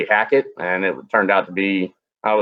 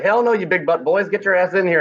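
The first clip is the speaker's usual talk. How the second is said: higher, playful call to get in here; rough-house invite, pitched up; rowdy call out; 8 semitones higher than usual. Retold or imitated. Shouting at freezing buddies.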